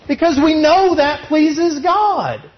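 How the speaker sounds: slow, high, with "know" punched; emphatic refrain. Acted. Preacher repeats his refrain, leaning on know. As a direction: lean hard on "know".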